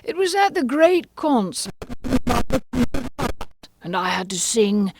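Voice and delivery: high, excitable Mad Hatter; fussy, animated gentleman. A narrator's reading.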